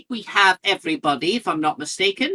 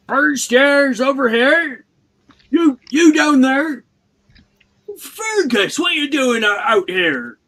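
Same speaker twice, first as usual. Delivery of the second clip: slow, big booming giant; Hagrid voice; 9 semitones higher, 6 dB louder, slower than usual. Acted. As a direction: call out like a hearty groundskeeper. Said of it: "he sails".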